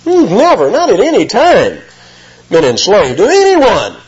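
mock the proud crowd's denial; indignant protest. Crowd answering Jesus, high indignant denial. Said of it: "that's an emphatic negative there".

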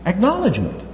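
"Acknowledgement" lifted high and set apart; single word held up for the congregation; preacher underlining "Acknowledgement"; repeated for emphasis. Shown as a demonstration. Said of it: "that is acknowledgement".

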